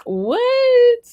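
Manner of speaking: very high, drawn-out what; disbelief. Real, not acted. The host reacts to the elder's confession, very high and incredulous. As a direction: let it rise in pure disbelief.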